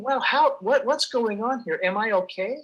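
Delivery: mildly baffled inner voice, retold; retold confusion. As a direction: recall being puzzled, pitch lifted. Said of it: "I was a little confused, thinking".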